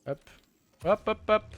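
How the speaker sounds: urgent little hops; excited hop. Real, not acted. Hurrying in the game.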